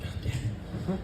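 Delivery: breathless whisper under his breath; whispered god damn. Retold or imitated. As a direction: hiss it so grandma can't hear. Kid's reaction to grandma's beating.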